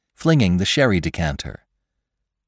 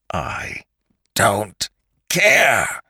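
screamed defiance; 3 semitones higher, much slower than usual. A narrator's reading. Attacker starts choking the man, screamed defiance. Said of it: "screamed Lantry".

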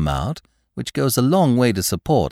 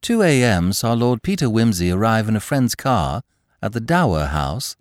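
ordinary delivery, nothing marked

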